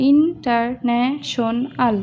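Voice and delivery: International slowly in five beats, IN, TAR, NA, SHON, AL; split into syllables. Shown as a demonstration. Teacher offering another split of international, syllable by syllable. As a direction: say international one syllable at a time. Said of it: "যদি এটা আলাদা করেও দেই".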